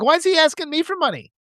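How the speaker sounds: lightly voiced wife asking why; incredulous wife. Imitating his wife puzzled by a donation text. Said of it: "She's like".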